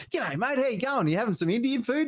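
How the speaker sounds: high, chirpy matey hello; over-friendly greeting. Retold or imitated. Dad greeting a random bloke, high and chummy. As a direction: greet the stranger far too cheerfully. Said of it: "I was just going".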